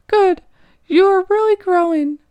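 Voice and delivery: praising mentor. Acted. Nguyen praises tearful narrator.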